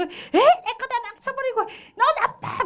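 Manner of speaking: put-on very high-pitched angry kid voice; acted child's yelling. Child fighting with sibling over blocks, yelling. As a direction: imitate a child's squealing tantrum. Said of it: "막 소리를 지르면서".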